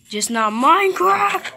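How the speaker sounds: high, loud punchline yell; hammed-up loud punchline; joking shout. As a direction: shout the twist gleefully. Joke punchline about animals.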